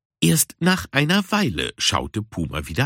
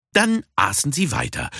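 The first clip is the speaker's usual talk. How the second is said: plain reading voice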